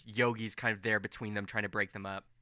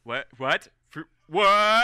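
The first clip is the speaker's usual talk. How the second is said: rising, louder disbelief; freaking out; 6 semitones higher, 10 dB louder, slower than usual. Acted. Gate guards seeing Colm return, freaking out. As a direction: splutter into a high, loud "what". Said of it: "freaking out 'cause they're like".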